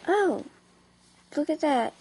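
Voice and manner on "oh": ordinary talk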